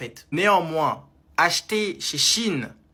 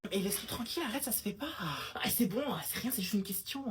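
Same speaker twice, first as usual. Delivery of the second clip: hushed scolding; 3 semitones higher, 10 dB quieter than usual. Acted. A friend quietly telling her to leave him alone. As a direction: scold gently under your breath.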